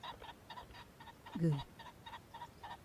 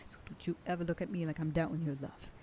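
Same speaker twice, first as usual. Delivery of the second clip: ordinary delivery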